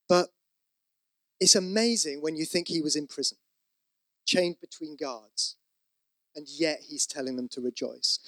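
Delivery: ordinary delivery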